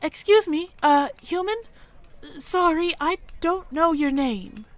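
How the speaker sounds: high, timid little mouse voice; a nervous young mouse working up courage; shy mouse girl. Acted. Mia the mouse approaching the human stranger, timid and high.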